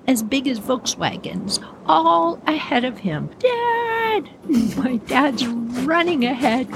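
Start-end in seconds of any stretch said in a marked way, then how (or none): 3.4-4.3 very high acted cry for help; panicked call. Brother hurtling toward the lake calls Dad, high panicked cry. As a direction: cry out in mock panic, pitch way up.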